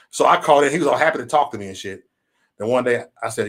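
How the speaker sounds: ordinary delivery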